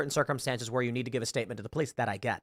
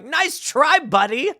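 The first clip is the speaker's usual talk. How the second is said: mocking dismissal; 11 semitones higher, 5 dB louder, much slower than usual. Mocking a scam caller on phone.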